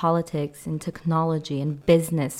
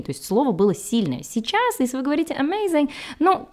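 ordinary talk, nothing marked

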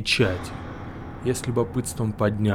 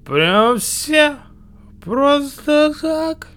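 put-on drunk voice; 8 dB louder, much slower than usual. Drunk hero's disbelief.

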